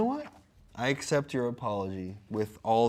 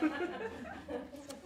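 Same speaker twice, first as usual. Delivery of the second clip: giggling laugh, pitched up; high breathy laugh; 10 dB quieter than usual. Real, not acted. Laughing at absurd metaphor.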